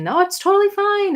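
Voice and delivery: high, loud mock-sweet reassurance; mocking quote. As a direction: parody their breezy promise, pitched high. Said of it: "them saying".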